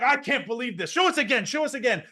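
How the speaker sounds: high, loud, thrilled demand; excited yelling. Retold or imitated. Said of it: "And just screams, like".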